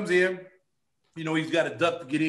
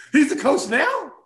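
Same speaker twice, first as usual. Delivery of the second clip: higher, louder and slow, in disbelief; can't believe what you just heard; shocked disbelief; 7 semitones higher, 7 dB louder, slower than usual. Retold or imitated. Player learning he fought the coach, louder higher disbelief.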